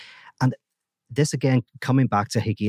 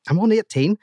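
much higher, louder, in disbelief; incredulous protest; 11 semitones higher, 4 dB louder than usual. Acted. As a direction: object as if God has lost it. Seamus protests God's hit.

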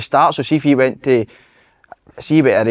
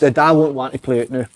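ordinary talk

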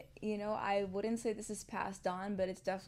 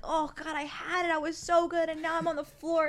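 put-on higher, louder, whiny kid wailing; hammed-up upset child; crying kid; 9 semitones higher, 8 dB louder than usual. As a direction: play a child who just fell off the bike. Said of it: "my kid fell off the bike and they started crying".

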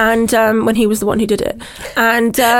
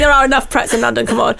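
usual speaking voice

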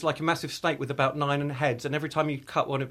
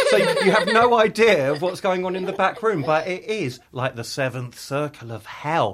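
ordinary talk, nothing marked

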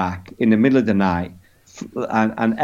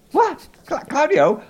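very high, stammering astonishment; mock disbelief. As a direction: squeak it in comic bafflement. Acted disbelief at hotel name.

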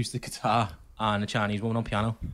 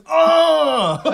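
high loud yelp of pain; 14 dB louder than usual. Retold or imitated. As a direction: cry out as the head hits the wall. Banging head, retold yelp.

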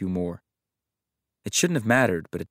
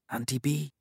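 soft, hushed, reverent; whispered like an incantation; 5 semitones higher, 5 dB quieter than usual. Acted. Sleepy child softly whispering her aunt's name, hushed and reverent. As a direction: breathe the name like a spell. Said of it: "she whispered like an incantation".

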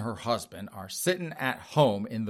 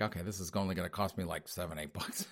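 fast, much softer grumble to himself; husband muttering aside; 7 semitones lower, 13 dB quieter than usual. Retold or imitated. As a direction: say it under your breath, counting the cost.

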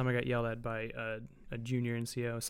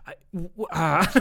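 imitating speechless stammer; 5 semitones higher, 7 dB louder than usual. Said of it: "And he's like".

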